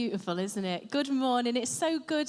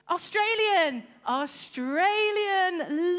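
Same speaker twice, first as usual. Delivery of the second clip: Australian accent; 4 semitones higher, 3 dB louder, much slower than usual. Shown as a demonstration. Echoing audience accent, drawn out.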